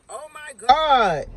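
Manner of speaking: drawn-out slow gasp of surprise; shocked oh my god. Retold or imitated. Seeing her coworker, a slow stunned oh my god. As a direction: stretch it out, stunned. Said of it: "I'm like".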